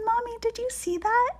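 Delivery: high-pitched, eager question; excited child. A narrator's reading. Girls run to their mommy after the ride, high excited question. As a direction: call out high and thrilled.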